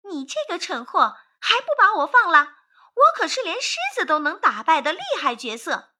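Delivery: high, loud indignant little voice; high-pitched outraged squawking; furious mosquito. A narrator's reading. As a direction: shout angrily like a tiny, puffed-up bug. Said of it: "蚊子怒气冲冲地说".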